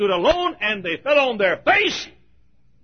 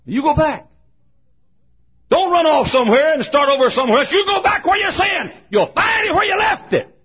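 preaching shout; 8 semitones higher than usual. Real, not acted. Pastor urging expelled students to go back, shouting. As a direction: drive it home at the top of your voice.

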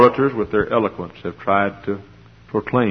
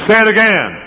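mother urging; 12 semitones higher, 6 dB louder than usual. Retold or imitated. Mother urging her little son.

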